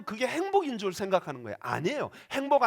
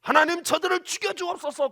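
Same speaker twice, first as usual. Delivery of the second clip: loud, higher cry for revenge; vengeful prayer; 9 semitones higher, 6 dB louder than usual. Pretend. Stephen's imagined wrong prayer against stoners, vengeful loud cry. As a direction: demand God strike them down.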